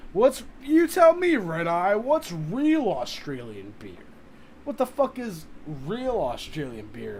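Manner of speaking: loud, high, worked-up challenge; incredulous outburst. Real, not acted. Streamer demanding chatter Red Eye name a real Australian beer, loud and incredulous. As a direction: throw the question at chat in disbelief. Challenging chat about Foster's.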